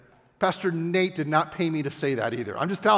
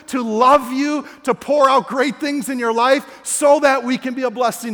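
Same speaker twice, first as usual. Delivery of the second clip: loud, high, pleading proclamation; God's impassioned appeal; 6 semitones higher, 8 dB louder than usual. Retold or imitated. God telling the church to know his heart, loud and impassioned. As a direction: proclaim it loudly, urging them to understand. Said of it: "And God's saying to us".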